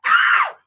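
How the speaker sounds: a very high squeal of joy; excited squeal. Real, not acted. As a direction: shriek with delight. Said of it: "that's exactly how I feel".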